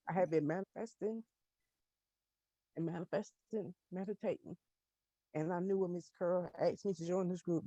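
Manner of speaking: fighting tears, barely above a murmur; real emotion, trying not to cry; holding back tears. Real, not acted. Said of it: "I'm trying not to cry".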